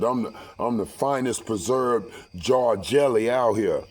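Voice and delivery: ordinary talk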